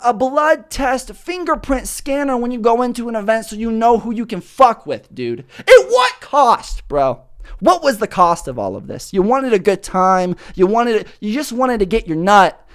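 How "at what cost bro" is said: very high, very slow, stretched-out plea; dramatic question. Acted. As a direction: ask it like a grand tragic hero.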